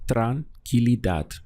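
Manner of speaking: tranquilidad said slowly, every syllable clear; slow full word. Shown as a demonstration. Teacher reassembles the word slowly for learners. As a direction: slowly put tranquilidad back together. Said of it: "Let's go through the syllables".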